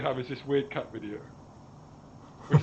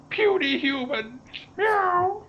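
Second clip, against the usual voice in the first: slow, high cat-alien sneer; booming arrogant alien overlord; 11 semitones higher, 3 dB louder, much slower than usual. Pretend. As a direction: gloat like a villainous cat alien. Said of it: "record a thing that says".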